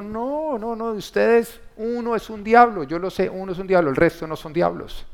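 a hint of Jesus, high and earnest; voicing Jesus. Jesus telling the twelve only one is a devil, high earnest voice. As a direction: speak it as Jesus reassuring his disciples. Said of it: "Él dijo".

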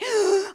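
a sudden high gasp; quick startled gasp; sharp gasp. Retold or imitated. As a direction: gasp at the thought. Gasp mid-complaint.

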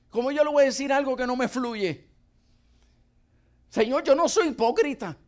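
high, urgent protest to the Lord; pleading with God. Retold or imitated. Preacher retells arguing with God, high pleading.

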